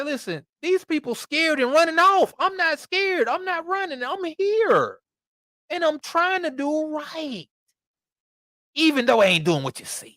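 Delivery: high, insistent excuse-making; mock brave Saul. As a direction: play Saul puffing himself up.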